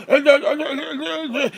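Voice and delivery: high-pitched inarticulate babbling imitation; mock a preacher tripping over words; drawn-out goofy stutter, pitched high; mock stammering babble. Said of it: "He can't even form a fucking sentence proper".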